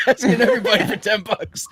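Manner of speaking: words broken by high laughter; laughing. Real, not acted. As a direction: laugh while you get the line out. Laughing through the retold line.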